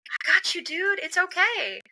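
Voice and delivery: high, gentle calming of an upset caller; soothing reassurance. Retold or imitated. Retailer on the phone being calmed down, high soothing voice. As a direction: soothe him, every word kind. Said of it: "that wasn't to be condescending".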